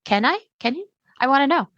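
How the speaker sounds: high, unsure questioning voice; unsure podcaster voice. Pretend. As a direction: play a nervous host asking for help. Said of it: "And they're like".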